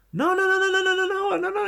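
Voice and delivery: high, rapid string of nos; acted panicky backpedalling; flustered denial. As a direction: blurt it in a mock fluster. Acting flustered denial in story.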